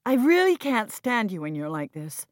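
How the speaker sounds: loud, harsh outburst; exclaiming harshly. Acted. Viv rounding on cold brother, harsh exclamation. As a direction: snap at him with real heat. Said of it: "I exclaimed".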